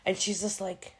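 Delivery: usual speaking voice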